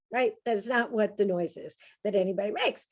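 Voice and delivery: ordinary talk, nothing marked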